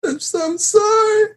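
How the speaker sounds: very high, slow mock sobbing; hammed-up crying whine; tearful apology. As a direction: wail the apology in fake tears. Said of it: "I was in tears".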